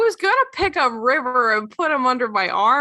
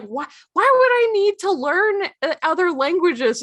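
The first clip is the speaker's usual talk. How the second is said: put-on high, whiny kid voice; whiny teen; 9 semitones higher, 3 dB louder than usual. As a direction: complain like a bored teenager. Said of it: "Perry's like".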